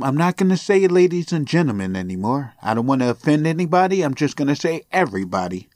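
normal talking voice

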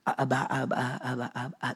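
put-on soft, fading little-child Abba; childlike calling. Preacher imitating a toddler calling its father, soft. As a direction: coo it like a toddler calling dad. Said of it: "That's what they're saying when they're little".

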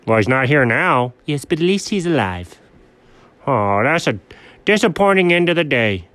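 ordinary talk

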